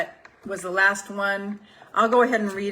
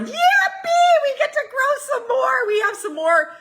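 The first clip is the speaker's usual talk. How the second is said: squealing, very high and loud yippee; joyful universe; 6 dB louder than usual. Retold or imitated. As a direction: shriek with childlike delight as the universe. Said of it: "universe is going".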